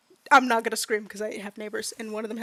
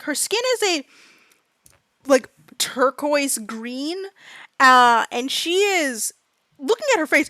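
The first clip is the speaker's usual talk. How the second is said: ordinary talk, nothing marked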